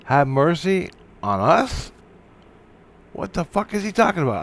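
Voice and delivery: high-pitched disbelieving question; incredulous. Retold or imitated. Reacting to the man's scream as he leaves, incredulous. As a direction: echo it back in baffled disbelief.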